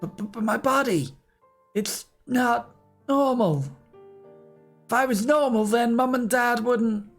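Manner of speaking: high, stammering distress; shaky, fearful girl. Acted. Kaine confesses her fear to her grandmother, high and stammering. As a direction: stammer out the shame.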